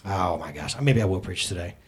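ordinary talk

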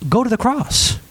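usual speaking voice

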